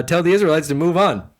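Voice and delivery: high, loud order; loud command. Acted. God orders Moses, loud and commanding. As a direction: bark it out as a decree.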